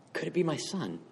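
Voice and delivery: soft, almost breathless hope under the breath; hushed wondering. Acted. Father squinting down the driveway at a figure, hushed wondering. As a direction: half-whisper it as if daring to hope.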